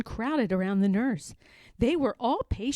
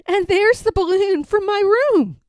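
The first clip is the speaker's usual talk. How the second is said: put-on high, loud, delighted boy voice; a kid spotting his own balloon on the tree; laughing little boy; 9 semitones higher, 7 dB louder than usual. Boy spotting his balloon among the decorations, high and gleeful. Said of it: "A boy laughed".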